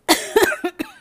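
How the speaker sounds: sudden hard coughing; coughing fit. Real, not acted. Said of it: "I'm choking".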